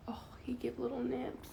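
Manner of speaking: soft unvoiced whisper. Real, not acted. Whispering to the nibbling kitten on her lap, softly. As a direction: whisper it so the kitten stays calm.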